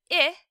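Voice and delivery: the short I of big, said alone; a short, crisp I; short I sound. Shown as a demonstration. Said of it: "which says".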